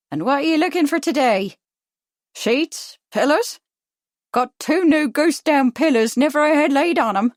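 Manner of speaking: high, loud Cockney sales pitch; Cockney shopkeeper with a touch of Irish; harsh Cockney accent. A narrator's reading. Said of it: "harsh with Cockney accents".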